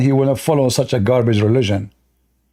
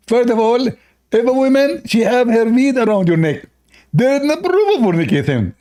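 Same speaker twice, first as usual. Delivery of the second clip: higher-pitched mock preacher voice, stretched out; Zakir Naik; 7 semitones higher than usual. Debater mocking his opponent, in a higher mock voice. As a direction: lecture in a mocking imitation. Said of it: "Zakir Naik".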